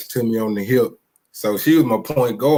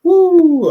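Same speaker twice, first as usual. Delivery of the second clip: loud, high, sustained "woo"; excited whoop; 7 dB louder than usual. Real, not acted. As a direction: let out a hype whoop for the city. Reacts to Houston in word game.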